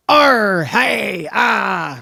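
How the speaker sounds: loud growly pirate shout. Shown as a demonstration. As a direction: bark it out like a rowdy pirate. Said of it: "Can you talk like a pirate?".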